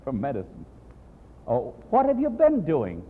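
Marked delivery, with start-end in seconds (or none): 1.5-3.0 teacher's question. Retold or imitated. Teacher asks the students.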